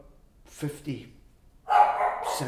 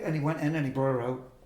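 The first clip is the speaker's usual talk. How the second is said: plain reading voice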